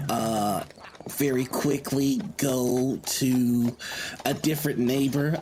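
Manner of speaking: ordinary talk, nothing marked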